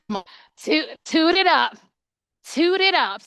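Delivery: high, loud sing-song cheering; playful cheer. Retold or imitated. Esthetician cheering a nervous client, loud sing-song chant. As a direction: hype her up like a cheerleader.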